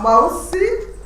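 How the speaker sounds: ordinary talk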